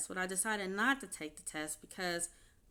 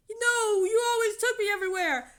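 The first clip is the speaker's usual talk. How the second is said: much higher, fast whiny mock voice; mocking ex-husband; 13 semitones higher, 11 dB louder than usual. Ex-husband complaining she always drove him, high whiny mockery. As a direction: squeak it out like a helpless child.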